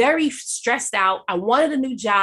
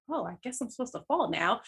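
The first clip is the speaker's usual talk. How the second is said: much softer, as if strength is draining away; faint, weakened aside; weak and faint; 9 dB quieter than usual. Retold or imitated. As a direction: let it fade, almost too weak to speak. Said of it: "And I got really weak".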